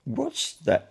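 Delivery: high, disbelieving repeat; incredulous echo. A narrator's reading. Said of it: "repeated the policeman".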